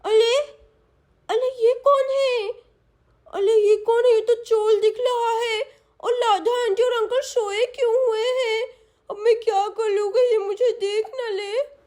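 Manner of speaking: put-on high, childlike lisping voice; worried small girl drawing out her words in fright; alarmed little girl. Little girl spots the thief.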